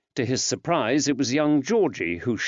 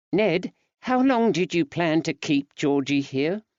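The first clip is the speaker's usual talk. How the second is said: woman's voice; 6 semitones higher than usual. Acted. Betsy asking husband.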